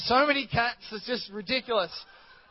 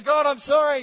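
higher and louder, hammed-up plea; mock pleading apology; 7 semitones higher, 10 dB louder than usual. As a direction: cry out the apology. Believer voiced apologizing.

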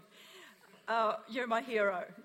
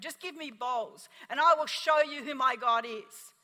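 defiant declaration; 8 dB louder than usual. Retold or imitated. Defiant demand at the door.

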